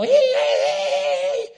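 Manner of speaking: high, loud excited call; loud hey. Shown as a demonstration. Loud preacher greeting the crowd, high, loud excited call. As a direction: shout hey like you can't contain it. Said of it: "Because I'm always all".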